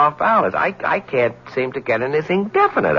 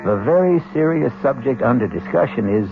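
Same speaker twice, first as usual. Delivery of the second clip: measured scene-setting narration over music; old-time radio narrator opening an act; radio drama narration. A narrator's reading. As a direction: paint the scene for listeners, with a pointed lift on witchcraft.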